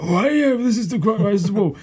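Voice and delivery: high, excitable game-show host impression; Danny Dyer. Presenter introducing his game show, hammed-up impression. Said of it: "he'll come and be like".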